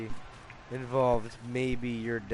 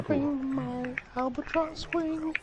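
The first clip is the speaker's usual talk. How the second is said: high silly bird voice; 5 dB louder than usual. Pretend. Playing a flapping bird.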